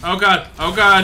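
alarmed outburst. Real, not acted. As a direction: blurt it loudly in alarm. Alarm as danger appears.

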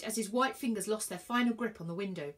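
plain reading voice

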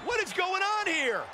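high, indignant ringside shout; outraged disbelief. Real, not acted. Commentator protesting an unscheduled match, outraged.

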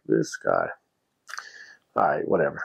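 exasperated aside. Retold or imitated. Annoyed at the condescending man.

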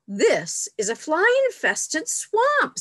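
slow, high-pitched storybook protest; acted complaint. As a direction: object loudly, stretching each word. Cub objects to picnic spot.

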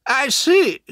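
high, loud acknowledgement; aunt's curt reply. A narrator's reading. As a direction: take it in with a pointed, high reply. Aunt responds coolly to accusers.

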